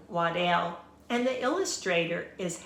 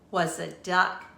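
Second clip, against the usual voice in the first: ordinary delivery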